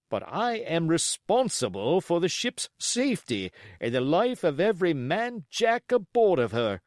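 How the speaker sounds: firm, clipped sea captain voice; stern captain. Acted. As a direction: lay down the law as captain.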